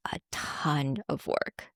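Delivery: slow, breathy whispered aside; soft slow whisper of effort. Real, not acted. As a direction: drop to a whisper to confess it. Admitting the hard effort.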